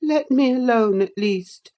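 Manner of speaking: put-on high, fretful boy; whining, petulant dismissal; peevish boy. A narrator's reading. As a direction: send her away crossly. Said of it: "said he".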